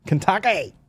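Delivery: Kentucky in a high mock-Australian voice; Aussie accent. Comic showing workmates' funny word, mock Aussie accent. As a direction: put on a high-pitched Aussie twang. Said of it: "in, a, in an Aussie accent".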